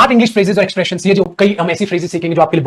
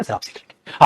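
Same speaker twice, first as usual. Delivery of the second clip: whisper; 8 semitones lower, 7 dB quieter, slower than usual. Retold or imitated. Student quote, whispered.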